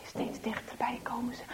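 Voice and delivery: whispered "steeds dichterbij komen ze", stretched. A narrator's reading. Narrator whispering the boys' creeping approach. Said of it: "repeated whispered phrase".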